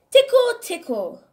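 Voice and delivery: high, loud squealed tickle; playful tickle squeal. Acted. Tickling the child, a loud high gleeful squeal.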